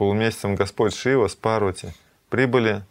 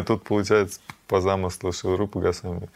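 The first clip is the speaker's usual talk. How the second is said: ordinary talk, nothing marked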